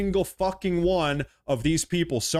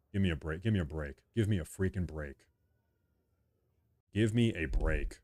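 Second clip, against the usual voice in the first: much softer, low and fed up; quietly fed-up grumble; exasperated mutter; 9 semitones lower, 7 dB quieter than usual. Real, not acted. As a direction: mutter it under your breath in disgust. Exasperated over the debt talk.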